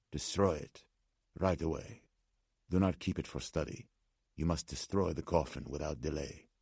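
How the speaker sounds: put-on restrained, urgent Slavic-accented old man. A narrator's reading. Old man urging the coffin's destruction, insistent Slavic accent. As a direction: instruct insistently without raising the voice, Slavic accent. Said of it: "The man spoke with an accent".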